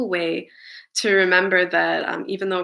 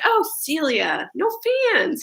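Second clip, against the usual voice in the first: coworkers imitating Celia's accent; 8 semitones higher than usual. Coworkers quoting Celia to each other, thick accent. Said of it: "they would do the, the accent".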